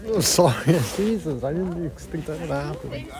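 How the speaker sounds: choked-up apology, voice breaking through tears; getting emotional. Real, not acted. To the audience, overcome while telling his story, tearful. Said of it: "I'm getting emotional".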